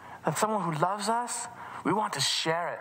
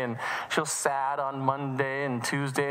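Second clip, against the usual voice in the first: usual speaking voice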